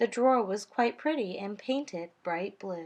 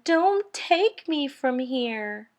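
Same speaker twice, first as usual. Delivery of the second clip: frightened plea; 5 semitones higher, 5 dB louder, slower than usual. A narrator's reading. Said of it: "He yelled to the man".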